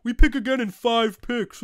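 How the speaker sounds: ordinary talk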